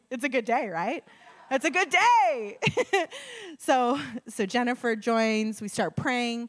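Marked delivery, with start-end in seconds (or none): 1.5-2.6 high, loud, laughing through "It's a good day"; delighted giggly repeat. Real, not acted. 2.6-3.5 bright burst of laughter; happy high cackle; high joyful laugh. Real, not acted.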